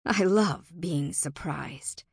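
plain reading voice

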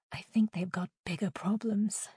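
soft, hushed aside; whispering. A narrator's reading. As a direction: whisper it weakly to a friend. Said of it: "Jade whispered".

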